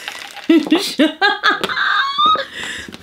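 high squeal of delight; delighted squealing laugh. Real, not acted. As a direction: squeal with joy at a gift.

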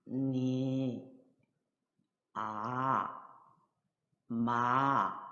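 "니, 아, 마" each long and low in third tone. Shown as a demonstration. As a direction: draw out "니", "아", "마" one by one at a low pitch. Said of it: "삼성 발음은". (니, 아, 마를 낮고 길게 삼성으로)

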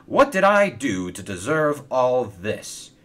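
loud, woeful complaint; exasperated. Acted. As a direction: wail at the unfairness. Stephen laments the lost paint.

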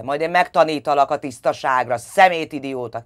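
loud furious shouting; father yelling while tying her up. A narrator's reading. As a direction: scream the threat at her. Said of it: "kiabált".

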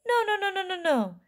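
high, rapid-fire protest; alarmed cry. A narrator's reading. Wonka tries to stop the boy, high alarmed cry. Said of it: "cried Mister Wonka".

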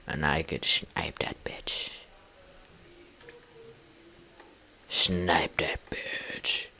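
hushed scheming whisper. Real, not acted. Gamer eyeing a creeper, whispering about sniping it.

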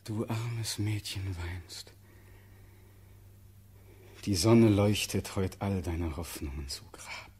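very low, subdued and grieving; sorrowful tenderness. Acted. Doomed prince consoling the weeping girl who loves him, sorrowful tenderness. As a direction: console her softly from deep resignation.

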